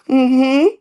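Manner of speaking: very high put-on mom voice; high mock motherly reply; his mom. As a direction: impersonate your mother answering the teacher. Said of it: "act like my mom".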